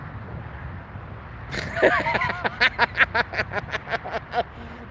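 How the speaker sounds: real high-pitched laughter; long, high, rattling laugh; long laughing fit. Real, not acted. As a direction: crack up and keep laughing. Cracks up at own remark.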